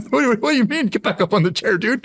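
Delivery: high, squeaky mock disbelief; very high, laughing what-do-you-mean to the boss; incredulous disbelief. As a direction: play the stunned rookie who can't believe the order. Rookie stunned by order back.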